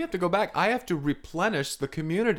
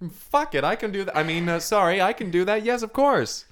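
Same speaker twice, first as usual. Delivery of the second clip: much higher, louder eager outburst then fumbling apology; eager blurt; 7 semitones higher, 5 dB louder than usual. Pretend. [name] to [name], a high eager blurt. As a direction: blurt it out, then scramble to fix it.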